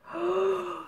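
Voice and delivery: high soft gasp; a real little gasp of wonder; delighted gasp. Real, not acted. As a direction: catch your breath in awe. Admiring the watch.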